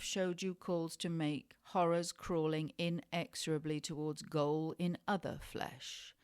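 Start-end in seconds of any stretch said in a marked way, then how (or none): none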